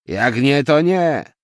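loud, high brush-off; curt angry retort. A narrator's reading. Taxi driver closes the argument with passenger, loud and annoyed. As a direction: dismiss her loudly. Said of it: "skwitował taksówkarz".

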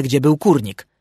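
ordinary delivery, nothing marked

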